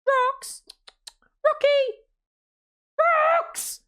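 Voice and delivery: high calling voice, a mother waking her son; mum calling out. Retold or imitated. Mum waking her son at half four, high calling voice. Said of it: "my mum going".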